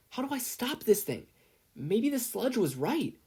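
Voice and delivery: put-on high, anxious young voice; a teen losing control of his quirk, anxious and high; worried boy. A narrator's reading. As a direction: fret with rising dread. Izuku voiced, frightened.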